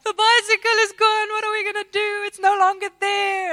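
high, loud hammed-up wail; crying by the car to her flatmate, hammed-up high wail; mock dramatic crying. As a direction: overplay the despair like a melodrama. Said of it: "crying about".

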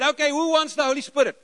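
high, loud call to the whole room; rally the crowd with a shout. Retold or imitated. Calling out to the room.

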